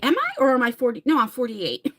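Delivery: normal talking voice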